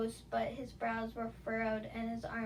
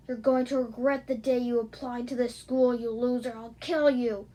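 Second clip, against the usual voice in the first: loud, enraged threat; furious shouting; 9 dB louder than usual. Acted. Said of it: "he shouted out even more angrily".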